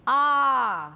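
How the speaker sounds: আ sustained loud and long; open held vowel. Shown as a demonstration. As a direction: let আ ring out before stopping. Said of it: "আ এসে থামছি".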